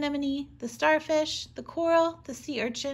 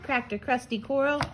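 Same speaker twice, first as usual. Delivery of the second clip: ordinary delivery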